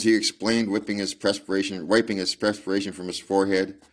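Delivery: usual speaking voice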